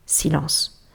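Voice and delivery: usual speaking voice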